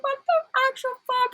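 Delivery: high squeaky shocked cry, pitch way up; stunned disbelief. Retold or imitated. Viewer reacting to the twist, in a high shocked squeal. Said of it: "you're like".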